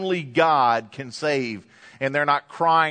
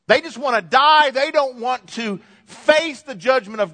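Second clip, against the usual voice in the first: preaching loud; 9 semitones higher, 5 dB louder than usual. Real, not acted. Preacher building to a climax.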